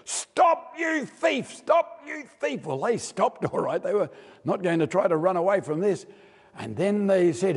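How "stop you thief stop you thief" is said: high, hammed-up chase cry from the army; pursuing soldiers shout after the brothers, high mock yell. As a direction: call out like soldiers giving chase. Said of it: "yelling, shouting".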